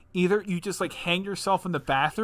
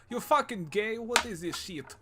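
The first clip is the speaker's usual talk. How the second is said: higher-pitched put-on Russian accent; mock Russian accent; 6 semitones higher than usual. Imagined partner discovering his channel, in a mock Russian accent. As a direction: imitate her in a bad Russian accent. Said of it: "I don't know why she's Russian".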